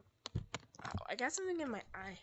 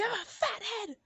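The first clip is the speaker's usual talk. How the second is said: teasing shout; 6 semitones higher than usual. Real, not acted. Teasing friend in game.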